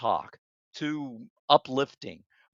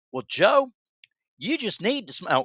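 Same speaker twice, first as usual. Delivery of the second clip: put-on Alabama accent; 7 semitones higher than usual. Quoting an Alabama college buddy to the co-host, imitated Alabama accent. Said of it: "he was from Alabama".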